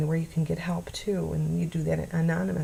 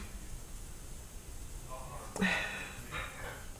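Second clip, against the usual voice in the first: long, much softer unvoiced sigh; long shaky sigh; 5 semitones lower, 14 dB quieter than usual. Real, not acted. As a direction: breathe out the heaviness. Pausing while comforting abuse survivors.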